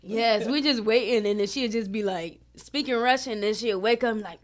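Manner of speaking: ordinary talk, nothing marked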